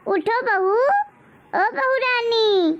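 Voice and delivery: high, very slow, sing-song "बहू Rani"; mocking call. Mother-in-law taunting the sleeping bride, long sarcastic call. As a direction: drag out the mock-sweet title.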